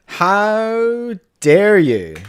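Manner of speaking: drawn-out, high, hammed-up "How dare you?"; mock outrage. Scolding the robot with hammed-up slow outrage. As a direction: stretch it out in theatrical offense.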